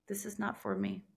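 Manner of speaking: soft, withdrawn murmur of resolve; quiet resolve. Retold or imitated. To herself at the club, a quiet resolve. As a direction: say it softly to yourself, decided.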